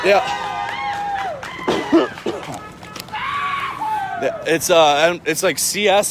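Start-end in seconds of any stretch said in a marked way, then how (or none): 0.5-4.1 choking coughs from tear gas; harsh coughing fit. Real, not acted. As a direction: cough hard, gasping. Walking through tear gas.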